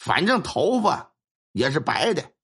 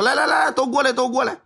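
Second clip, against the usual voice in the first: put-on beckoning the children over, higher and louder; old elder calling; 9 semitones higher, 3 dB louder than usual. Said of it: "这老者就对另一些孩子说". (白发老者提高嗓门招呼孩子们过来)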